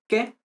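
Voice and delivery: "que" said alone as the accented syllable; stress on que. Shown as a demonstration. Teacher pointing out where the accent is, "que" stressed. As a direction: put the full accent on "que". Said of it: "and the accent here".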